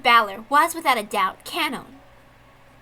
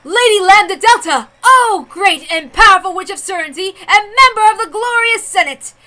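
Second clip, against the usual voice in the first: grand announcer call; 8 semitones higher, 9 dB louder than usual. Acted. Herald summoning the witch before the crowd, loudly and grandly. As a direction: call her forward before the whole senate.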